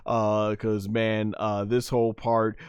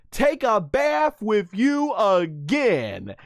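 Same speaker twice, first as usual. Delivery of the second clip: high, slow, drawn-out declaration; hammed-up high-pitched ultimatum; passionate mock yell; 7 semitones higher, slower than usual. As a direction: cry it out word by word like a fed-up cartoon wife. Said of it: "she yells that out with all her passion".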